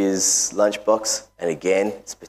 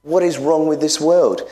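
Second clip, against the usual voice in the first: higher, pressing question; preaching climax; 7 semitones higher, 3 dB louder than usual. Real, not acted. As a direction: demand it of the congregation.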